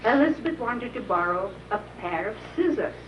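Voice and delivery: ordinary delivery, nothing marked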